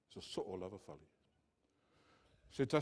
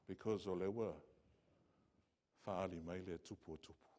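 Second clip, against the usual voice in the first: ordinary talk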